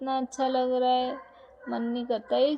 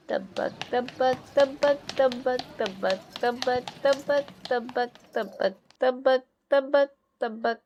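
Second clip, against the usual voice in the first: slow rhythmic clip-clop tabak; hoofbeat chant; slower than usual. Acted. Riding the horse with the child, slow clip-clop chant. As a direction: mimic horse hooves in rhythm.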